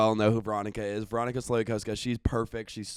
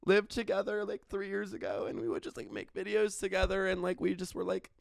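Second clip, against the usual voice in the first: braggy guy; 7 semitones higher, 4 dB quieter, faster than usual. Retold or imitated. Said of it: "not to be the guy who's like".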